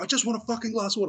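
impatient shout for water; mock angry yell. Barman imitating the customer snapping at him, mock angry yell.

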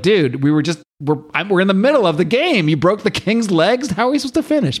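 mock-indignant, climbing high in disbelief; rising, incredulous complaint; indignant protest. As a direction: protest like a player whose game was ruined. Hypothetical chess player protests the broken piece.

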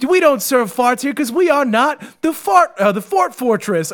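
mock stern chef. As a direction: declare it like kitchen law. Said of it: "he's like".

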